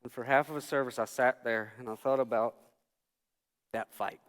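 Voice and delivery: normal talking voice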